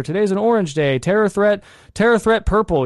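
lightly mocking news alert voice; mock official announcement. Mocking government terror alerts.

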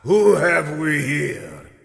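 high, slow hammed-up villain laugh; grand villain voice, slow and high; lush villain voice. A narrator's reading. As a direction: glide in as a theatrical villain. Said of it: "A lush voice reverberated".

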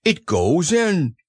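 slow, high bear voice; marvel, drawing the words out; stretched-out high wonder; admiring Pooh. Acted. Pooh marvels at balloon and pot.

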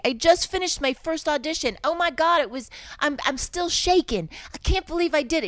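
high-pitched breaking news; giddy phone call. Retold or imitated. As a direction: re-enact still being shaken with excitement. Excited phone call after first audition.

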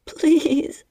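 high pleading please; blubbering. A narrator's reading. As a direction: plead through tears. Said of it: "I said, blubbering".

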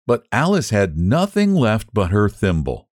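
ordinary delivery, nothing marked

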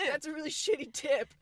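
mock scolding. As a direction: scold her for a bad tip, loudly. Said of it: "Oh, yeah, [name]".

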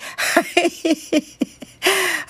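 a high, bright burst of laughter. Real, not acted. Laughs at own story.

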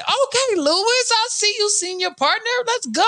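high, eager cheer; excited. Retold or imitated. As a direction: hype him up. Imagined enthusiastic partner.

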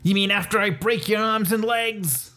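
high, put-on brawler voice for Guy; gravelly tough-guy accent. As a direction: snarl the threat in a thick accent. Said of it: "Good accent".

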